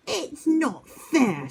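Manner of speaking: high, slow whining protest; sulky big brother; screeching owl. Acted. Boggle screeches at Twit's floating, high and slow. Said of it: "screeched Boggle".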